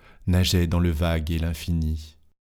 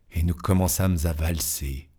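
ordinary delivery

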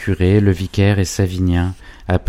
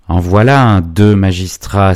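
exclaiming; 2 semitones higher, 7 dB louder than usual. A narrator's reading. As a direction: cry it out loudly, stretching the end. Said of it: "s'écria le maître de poste". (exclamation sonore et traînante)

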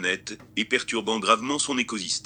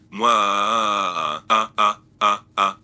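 loud cackling villain laugh; evil laugh; 6 dB louder, much slower than usual. Pretend. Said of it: "rire diabolique".

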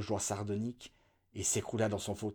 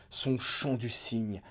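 ordinary delivery

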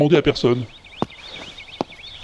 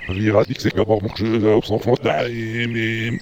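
reversed speech; played backwards. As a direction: talk as if the tape runs backwards. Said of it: "comme si tu parlais à l'envers".

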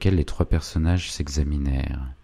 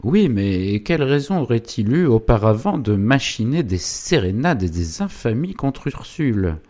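doubting wife, higher swinging tune; Zélie; 7 semitones higher, 4 dB louder than usual. Acted. Wife questions husband about infamies against Ursule, sceptical. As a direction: challenge the husband's excuse, pitch lifted.

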